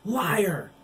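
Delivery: loud, high spat accusation; furious retort to Oliver. Acted. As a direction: spit the word at him. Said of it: "Billy spat".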